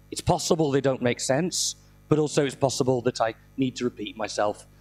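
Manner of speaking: ordinary talk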